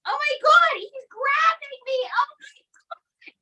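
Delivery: very high squeal into a breathless whisper; mock panic. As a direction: shriek as if grabbed. Puppet grabs her.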